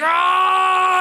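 high, loud playful roar of rage; mock superhero roar. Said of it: "He's like".

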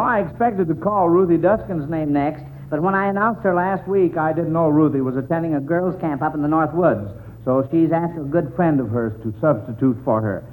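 usual speaking voice

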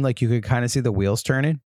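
ordinary delivery

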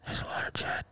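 breathy ghostly whisper of a strange voice; hushed creepy whisper from nowhere; eerie whisper. Retold or imitated. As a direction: whisper it like an unseen presence. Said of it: "Like that whisper".

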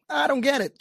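high-pitched, retold bafflement; mock puzzlement. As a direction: act lightly baffled, pitch jumping up. Retells his own puzzled reply.

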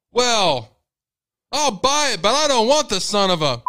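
grudging dad. Retold or imitated. Father grudgingly buying the meat, loud and high. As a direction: give in but keep cursing.

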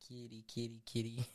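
quiet, soft rooster call; rooster crow imitation. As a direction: imitate the Spanish rooster crowing.

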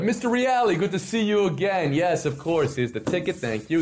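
high, eager front-desk greeting; chirpy hotel clerk. Retold or imitated. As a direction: play the overly cheerful clerk.

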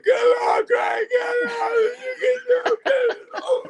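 high, loud desperate screams; frantic yelling. Retold or imitated. Ringside fan screaming for McGregor to escape the hold. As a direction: scream it like you're ringside and he's trapped. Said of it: "I'm looking like".

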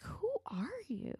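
high breathless whisper of disbelief; a stunned high whisper; incredulous whisper. Retold or imitated. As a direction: whisper it like you can't believe her. Friend's quote acted as disbelief.

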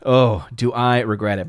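ordinary talk, nothing marked

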